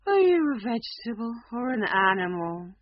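a lion too bored to care; tired lion. A narrator's reading. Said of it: "he asked tiredly".

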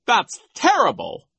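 high, loud dismay; outraged outburst; crying out. A narrator's reading. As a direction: exclaim in theatrical horror. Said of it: "Nero cried".

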